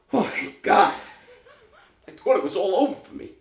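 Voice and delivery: loud, high exclamations half laughing; giddy relieved outburst after a scare; huge relief. Acted. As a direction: burst out with relief, then let the breath go. Acted relief after false heart attack.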